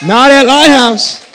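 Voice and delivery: high, loud shout of church pride; preaching shout. Real, not acted. Pastor rallying his congregation, shouted proudly. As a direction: belt it out with fire.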